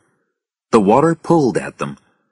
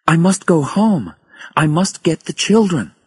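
higher-pitched, pressing plea; urgent worry; 10 semitones higher than usual. A narrator's reading. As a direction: voice lifts with worry for the children. Frightened mother needs her children.

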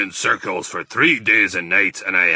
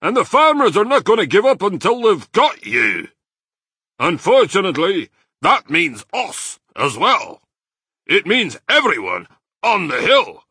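loud, high angry badger; furious; 10 semitones higher, 9 dB louder than usual. A narrator's reading. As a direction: rail at him at full volume. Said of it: "said Badger furiously".

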